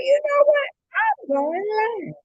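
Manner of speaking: high-pitched burst of resolve; excited self-quote. Retold or imitated. Host retelling why she went live, excited. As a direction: announce it brightly, starting very high. Quotes own sudden happy decision.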